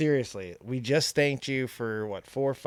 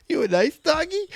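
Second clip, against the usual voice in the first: high, sweet and innocent; naive innocence; 12 semitones higher, 3 dB louder than usual. Retold or imitated. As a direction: ask it sweetly like a child. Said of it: "He sounded so innocent and sweet".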